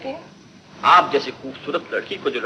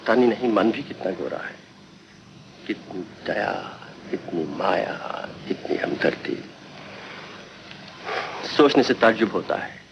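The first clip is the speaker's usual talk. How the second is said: dreamy soft musing; 9 semitones lower, 7 dB quieter than usual. Acted. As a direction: murmur it to yourself, lovestruck. Alone, dreaming of Renu. (मन ही मन खोया हुआ, धीमे स्वर में)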